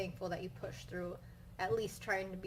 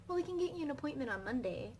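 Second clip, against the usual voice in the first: nurse voice; 5 semitones higher, faster than usual. Retold or imitated. Nurse on phone quoted.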